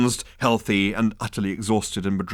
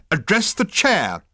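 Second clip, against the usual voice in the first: slow, higher, louder snap; snapped; 10 semitones higher, 5 dB louder, slower than usual. A narrator's reading. Consultant scolding Ford at the meeting, snapping. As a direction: bark the order at him. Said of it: "snapped the management consultant".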